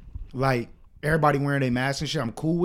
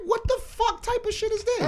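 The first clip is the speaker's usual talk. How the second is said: much higher, exasperated mock outburst; angry outburst; 3 dB quieter than usual. Masked guy pushes him, he snaps back in higher outrage. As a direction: let the disbelief fly up high. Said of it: "So now I'm on extra".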